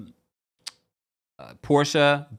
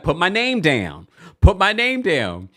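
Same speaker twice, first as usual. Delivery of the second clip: imitating a defiant challenge, raised pitch; mock demand, louder on the repeat; 6 semitones higher, 6 dB louder than usual. Acting out contestant's defiant challenge.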